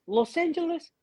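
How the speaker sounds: put-on high, eager child questions; put-on kid voice; imitated little kids. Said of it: "the little kids would be like".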